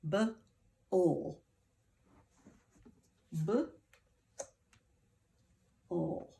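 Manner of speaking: "B-O-L" as buh, pause, ull; "B-O-L" sounded slowly, not as letter names; sounding out. Shown as a demonstration. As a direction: break "B-O-L" into separate sounds. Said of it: "Second syllable".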